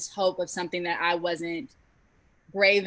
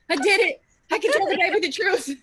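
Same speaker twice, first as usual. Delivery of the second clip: high, loud and fast triumph; triumphant joy; 11 semitones higher, 4 dB louder, faster than usual. Retold or imitated. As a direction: burst out with proud relief. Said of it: "I was like".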